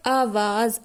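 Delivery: "आवाज़" as aavaaz, ending on z; teacher's clear aavaaz for "आवाज़"; z-sound demo. Shown as a demonstration. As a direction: carefully model "आवाज़" with a buzzing final z. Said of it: "आवाज़ नहीं होता".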